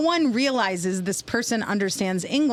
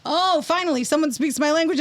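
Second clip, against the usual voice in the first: higher-pitched mock relief; imitated relieved patient; 7 semitones higher than usual. Russian patient thinking the ER worker speaks their language, mock relief. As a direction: exclaim it as a delighted patient, voice lifted. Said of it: "other times they'd be like".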